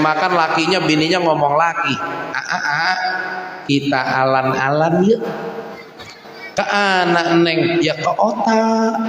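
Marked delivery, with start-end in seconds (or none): 2.3-9.0 put-on "alan-alan" and "otak" lisped childishly; slow cutesy lisp on "ke otak"; lisping baby talk. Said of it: "cadel-cadelin". (bicara cadel manja)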